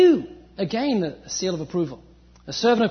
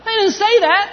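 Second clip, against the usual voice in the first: much higher and louder; emphatic; 11 semitones higher, 8 dB louder than usual. Real, not acted. Preacher rejecting the mock to his congregation, loud and firm. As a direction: ring it out as a firm denial. Preacher correcting the mocking quote.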